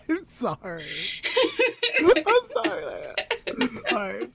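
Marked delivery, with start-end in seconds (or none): 0.1-3.1 giggling through a real apology; can't stop laughing while saying sorry. Real, not acted. Laughs apologising mid-story.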